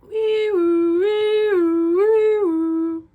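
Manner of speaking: loud high sung wee woo; siren imitation. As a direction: wail it out like a siren. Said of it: "do you hear that siren?".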